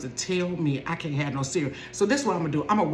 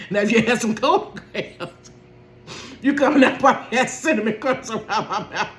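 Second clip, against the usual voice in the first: higher, louder, laughing throughout; laughing rant; 6 semitones higher, 3 dB louder than usual. Real, not acted. Mom laughing at herself over the cereal. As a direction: rant while cracking up.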